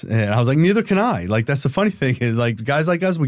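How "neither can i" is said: much higher, pointed retort; sarcastic comeback. Retold or imitated. Answering the agent who can't do surveillance, a sarcastic retort. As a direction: snap it back with irony.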